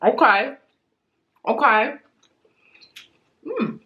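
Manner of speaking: talking between bites; chewing candy. Real, not acted. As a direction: mouth partly full. Seen 2: Right woman holds orange candy, bites and chews it while speaking.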